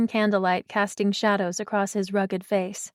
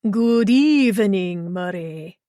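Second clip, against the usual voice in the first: slow Transylvanian vampire greeting, first words swelling; drawn-out Transylvanian accent; 4 dB louder, slower than usual. A narrator's reading. Costumed boyfriend greets her at the bookstore, playful Transylvanian drawl. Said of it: "drawing out his words in a Transylvanian accent".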